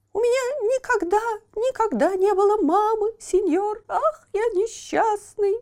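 put-on high lamenting child voice; pitiful puppet boy. A narrator's reading. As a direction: plead in a high thin voice. Buratino voiced in story.

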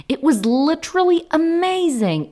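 high, loud and gushing "It was literally amazing"; emphatic excitement. Shown as a demonstration. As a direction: sell how amazing it was. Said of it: "приобретает такой тот самый нужный феноменальный оттенок".

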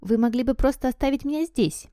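lifted, wishful request; hopeful. A narrator's reading. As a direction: suggest it with hope. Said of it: "с надеждой вставил Гарри".

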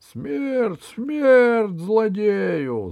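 high, loud, drawn-out howl of a cry; bay for blood, stretching the words; wolf's shout. Acted. Wolf demands death for the villain, high howling shout. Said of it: "закричал волк".

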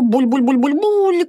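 rapid high bubbling imitation; bubbling gurgle. Tim dives to the bottom, bubbling like a frog. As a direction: bubble it out like someone underwater. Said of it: "забулькал".

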